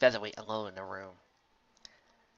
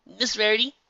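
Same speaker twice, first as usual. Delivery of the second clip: colt voice; 11 semitones higher, 7 dB louder than usual. A narrator's reading. Colt addresses Rarity.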